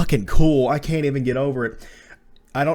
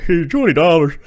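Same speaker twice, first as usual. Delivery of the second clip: raised, loud mocking country voice; higher and louder mocking imitation; Wesker; 4 semitones higher, 7 dB louder than usual. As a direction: call it out as an uncool country guy. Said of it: "He's like".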